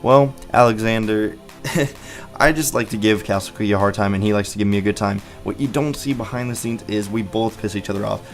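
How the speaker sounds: usual speaking voice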